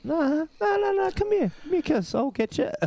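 high, squeaky hammed-up begging; mock eager husband. Husband mocking himself to his mate, high and hammed-up. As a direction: beg her in a silly high voice. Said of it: "And I was like".

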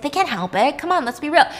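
plain reading voice

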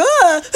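loud high shriek; startled scream. Retold or imitated. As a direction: scream in fright. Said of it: "and I'm like".

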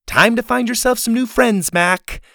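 huffy, high-pitched dismissal; high parting shot. A narrator's reading. Girl storming off, warning the friend, huffy.

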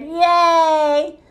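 high, drawn-out cheer. A narrator's reading. All the guests applauding Busby's tumble, drawn-out cheering. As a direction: cheer it out for a whole crowd. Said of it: "everybody cheered".

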